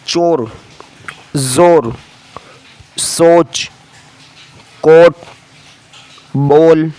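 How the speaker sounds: teacher reading words for the class to repeat; word drill. Shown as a demonstration. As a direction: call out each word clearly. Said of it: "मुफरदात".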